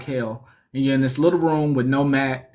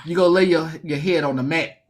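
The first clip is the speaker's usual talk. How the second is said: usual speaking voice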